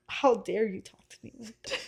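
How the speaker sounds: whispery, voiceless crying imitation; breath-only, wounded tearful protest; mock tearful whimper. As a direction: squeeze it out through pretend bawling. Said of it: "Start full on bawling, crying".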